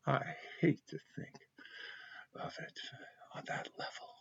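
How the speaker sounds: breaking into a whisper, close to tears; can barely get the words out; tearful whisper. Real, not acted. Vlogger chokes up talking about Melania, whispering through tears.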